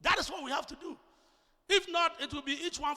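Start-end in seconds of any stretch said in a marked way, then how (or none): 0.0-1.0 loud, high pulpit exhortation; emphatic, shouted preaching; full-force preaching. Real, not acted. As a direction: rouse the believers with a raised voice.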